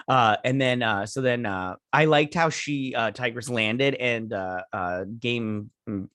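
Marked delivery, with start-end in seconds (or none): none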